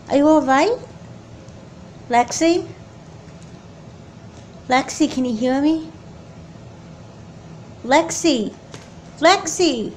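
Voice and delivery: shouting for someone who won't answer; calling out in alarm. Acted. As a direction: call her name as panic rises. Sister collapsed, calling her.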